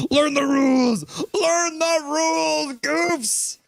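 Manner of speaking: high, loud, hammed-up wail; mock scolding shout. Streamer voicing Castle Siege scolding the chat, mock shriek. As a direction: stretch each word in mock outrage.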